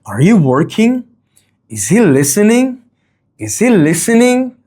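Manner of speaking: "working" and "listening" lifted high at the end; rising question intonation. Shown as a demonstration. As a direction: ask it with "listening" climbing in pitch. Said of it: "rising intonation".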